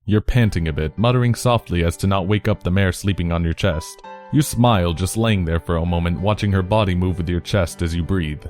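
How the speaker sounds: ordinary delivery, nothing marked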